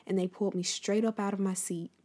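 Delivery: ordinary talk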